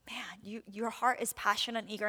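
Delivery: whispered, tender aside; soft whisper. Retold or imitated. The Lord fondly whispering to an eager intercessor. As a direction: breathe it like a fond secret. Voicing the Lord's fond reply.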